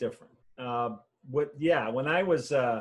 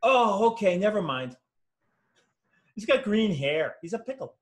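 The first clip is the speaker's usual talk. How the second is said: mock reluctant giving in; 6 semitones higher, 3 dB louder than usual. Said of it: "editor relents".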